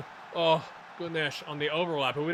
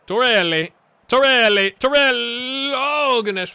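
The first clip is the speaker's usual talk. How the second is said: loud name call, climbing higher; urgent player call; 6 semitones higher, 10 dB louder than usual. Real, not acted. Gamer calls Torelli before a tackle, loud escalating shouts. Calling player during play.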